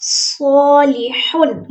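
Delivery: सॉलिहुन recited very slowly, heavy सौद, throaty हा; slow Quranic recitation. Shown as a demonstration. Teacher models the word for students, slow recitation. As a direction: draw out सॉलिहुन syllable by syllable. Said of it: "हा हलक से आवाज़ निकालनी है".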